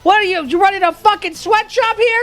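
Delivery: high, loud whiny begging; hammed-up plea to be set free; mock desperate plea. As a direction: wail like a trapped prisoner. Pleading to be freed from chat.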